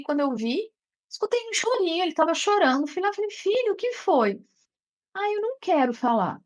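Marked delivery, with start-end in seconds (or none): 3.3-4.4 gentle mother. Retold or imitated. Mother to her crying son at bedtime, gentle and high. 5.1-6.4 lightly voiced sulky little boy, higher; a hint of a reluctant child. Son refusing to talk to his mother, lightly voiced sulky child.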